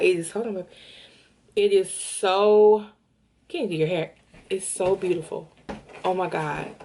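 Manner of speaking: ordinary delivery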